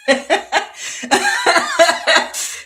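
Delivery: high, loud burst of laughter; real laughing fit. Real, not acted. Reader cracking up at own bad accent, loud laughter. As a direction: crack up helplessly.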